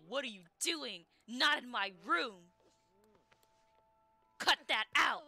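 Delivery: loud, slow, drawn-out mock outrage; angry dad shout. Dad walks in on them in his room, angry shout. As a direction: bellow it like a scandalized father.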